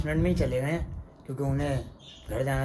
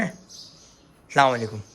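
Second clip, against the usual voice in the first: drawn-out, lower-pitched "अस्सलाम वालेकुम"; slow, low greeting; 4 semitones lower, slower than usual. Real, not acted. Uncle saying salaam to child, slow and low.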